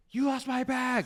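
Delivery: high, loud angry yell; screaming. Retold or imitated. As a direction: scream it at the airline desk. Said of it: "screaming in the airport".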